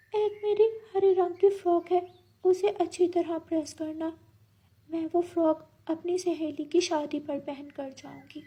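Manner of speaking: high, soft lady parrot voice; parrot wife, requesting. Acted. Parrot asking crow to press her party frock, softly requesting. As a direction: ask sweetly in a high, light voice.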